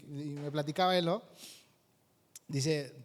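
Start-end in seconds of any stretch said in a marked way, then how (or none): none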